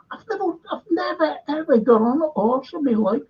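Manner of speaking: a high, incredulous squeal of alarm; mock panicked squeak. Actor retelling the director's horse request, high squeaky protest.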